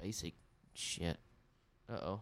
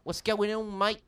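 higher New Zealand take on What's going on, mate; New Zealand vowels in mate, pitched up; New Zealand accent; 6 semitones higher, 4 dB louder than usual. Shown as a demonstration. Contrasting Australian with New Zealand, New Zealand accent. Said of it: "Instead of your".

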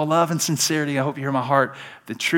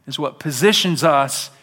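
usual speaking voice

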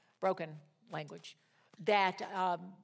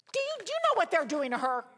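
higher, louder and fast, pleading to the attorney; urgent retelling; 8 semitones higher, 5 dB louder, faster than usual. Retold or imitated. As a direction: rush it out, worked up about her plight. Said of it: "I was talking just like this".

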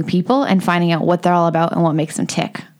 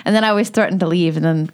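ordinary talk, nothing marked

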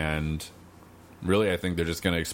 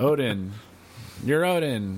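dramatic reveal; 5 semitones higher, slower than usual. Retold or imitated. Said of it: "It's like".

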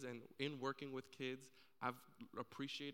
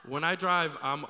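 loud, talking through real laughter; laughing; 16 dB louder than usual. Real, not acted. Preacher admitting his driving sins, laughing. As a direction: carry on while still laughing.

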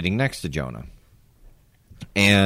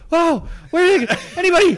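very high-pitched calling out; squeaky, hammed-up call for a witness; mock searching cry; 3 dB louder, faster than usual. Retelling himself looking around for people to share with, mock searching cry. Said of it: "And I was going".

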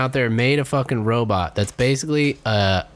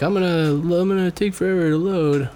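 mock whiny internet; 5 semitones higher than usual. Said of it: "It's always just like".

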